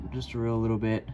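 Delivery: ordinary talk, nothing marked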